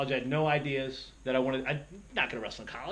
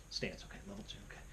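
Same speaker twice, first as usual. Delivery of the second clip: softly whispered self-coaching, under the breath; muttering to himself; 8 semitones lower, 16 dB quieter, faster than usual. Retold or imitated. Wrestler in his first match coaching himself, hushed mutter. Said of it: "And I'm talking myself".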